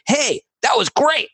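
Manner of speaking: high-pitched pleased exclamation; acted delight. Pitching to a client how they will celebrate, delighted. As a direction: act out delighted praise, pitched well up. Acts out reaction to success.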